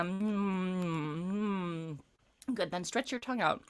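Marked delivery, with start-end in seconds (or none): 0.1-2.5 closed-mouth hum sliding down; focused chewing hum. Shown as a demonstration. Coach leading a warm-up, humming while chewing. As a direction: hum into the front of the face. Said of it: "a little more focused hum and chew".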